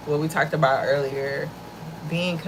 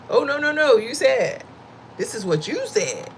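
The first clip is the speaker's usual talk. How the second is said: defensive retort; 8 semitones higher than usual. Retold or imitated. Retelling her own defensive reply.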